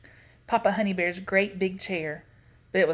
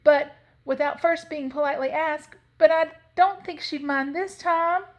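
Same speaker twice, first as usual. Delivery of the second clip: put-on higher, animated storybook girl voice; Leola talking to herself; 7 semitones higher than usual. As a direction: reason it out to yourself, pitched up.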